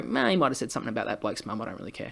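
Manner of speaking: low, soft shrug of a voice; mock indifference. Unmoved onlooker watching a flogging, indifferent. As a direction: toss it off, trailing away unbothered.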